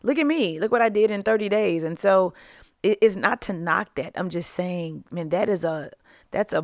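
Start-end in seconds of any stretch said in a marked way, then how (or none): 0.0-1.8 show-off influencer. Retold or imitated. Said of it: "So they're like".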